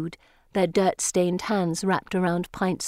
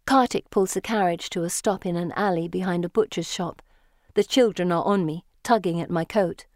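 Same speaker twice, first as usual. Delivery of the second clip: usual speaking voice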